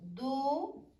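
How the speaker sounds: each word spaced out while writing; dictating an English question word by word to students. Shown as a demonstration. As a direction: set down one word at a time for the board.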